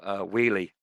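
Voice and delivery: usual speaking voice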